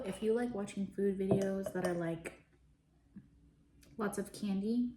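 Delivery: normal talking voice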